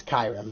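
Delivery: "Kyurem" said as KAI-rem; old mispronunciation. Shown as a demonstration. Player admitting an old way of saying Kyurem, as KAI-rem. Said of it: "I used to pronounce it as".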